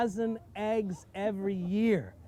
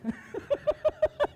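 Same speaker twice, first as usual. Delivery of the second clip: sudden high-pitched laugh; a shrieking burst of laughter; high squealing laugh. Real, not acted. Reacting to the cow's tongue.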